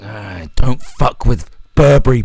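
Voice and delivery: loud, slow, hammed-up warning; menacing corporate threat. Corporation warning the girl off, loud mock menace. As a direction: boom out the threat word by word. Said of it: "and saying".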